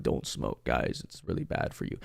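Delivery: low, half-voiced throat-tube speaker; mock public warning in a ruined, soft voice; anti-smoking commercial lady. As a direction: imitate a smoker talking through a tube. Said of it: "I actually sound like that one lady".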